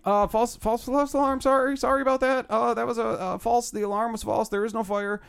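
flustered guy on the intercom; panicked announcer. Retold or imitated. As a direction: stammer it out like a rattled hotel staffer. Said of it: "he sounded, like, panicked".

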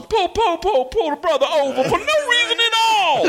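squeaky high mock cop-siren voice; mock high-voiced translator. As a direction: pitch way up, hammed-up translator. Imitating the translator's punchline.

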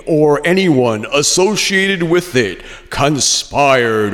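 slow, mock-ominous reading over music; sarcastic dramatic read. Shown as a demonstration. Reading the key finding to a listener, slow mock drama. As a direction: draw it out with a hint of sarcasm. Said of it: "I read it with a little hint of sarcasm".

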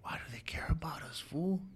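put-on little boy. Crying brother asks why anyone cares, hushed and boyish. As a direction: imitate a small boy asking in a near whisper. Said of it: "like a little boy, and he goes".

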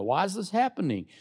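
ordinary delivery

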